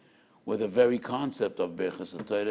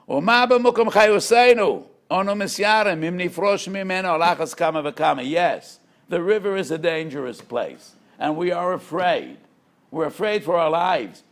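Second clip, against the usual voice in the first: high and loud, pleading for their lives; desperate high loud plea; impassioned fish's cry; 8 semitones higher, 10 dB louder than usual. Acted. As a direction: cry it out like a frightened fish begging to be understood. Fish answering the fox, pleading.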